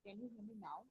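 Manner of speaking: very faint, far from the mic; barely audible. Real, not acted. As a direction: speak almost inaudibly. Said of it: "Your voice is very low".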